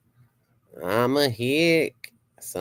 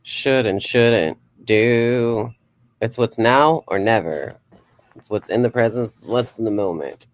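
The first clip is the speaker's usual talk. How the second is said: mystic pronouncement; 5 dB louder than usual. Acted. Channeling visions for viewers.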